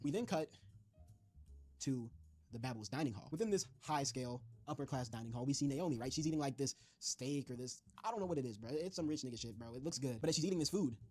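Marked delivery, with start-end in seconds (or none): none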